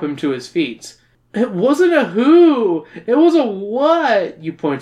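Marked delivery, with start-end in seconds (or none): 1.3-4.4 dazed voice. Acted. Narrator voices dazed character.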